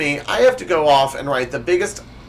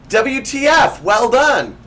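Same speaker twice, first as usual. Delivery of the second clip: cheerful mom voice; 9 semitones higher, 6 dB louder, slower than usual. Acted. Mom misunderstanding WTF.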